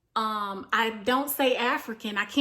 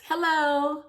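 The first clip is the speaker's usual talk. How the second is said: higher, duh-like hello; pointed "hello"; 6 semitones higher, 4 dB louder than usual. Real, not acted. Reminding viewers these are her ancestors, pointed. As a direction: say it as if it's obvious. Pointed aside, stating the obvious.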